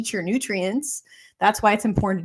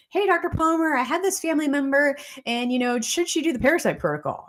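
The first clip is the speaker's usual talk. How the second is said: higher-pitched voice of a questioning patient; imitated patient; 5 semitones higher than usual. Patient asking the doctor about a family member, imitated higher voice. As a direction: play a patient casually asking for advice.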